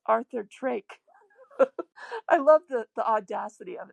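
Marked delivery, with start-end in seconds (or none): 1.6-2.2 a short, bright burst of laughter; laugh, tickled by grandpa's nerve; amused laugh. Real, not acted. Telling the audience about grandpa, a cracked-up laugh. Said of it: "It just cracks me up".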